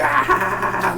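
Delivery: high mock shout; imitated yell. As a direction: act out a startled holler. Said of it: "He's like".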